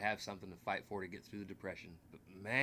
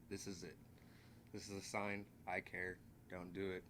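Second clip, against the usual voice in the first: sincere reassurance; 7 dB quieter than usual. Real, not acted. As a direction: speak softly to someone on the brink, promising they'll be okay. Speaking to suicidal viewers.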